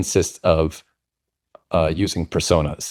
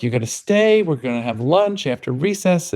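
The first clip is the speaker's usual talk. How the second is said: sing-song teacher voice; 7 semitones higher than usual. Retold or imitated. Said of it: "They're like".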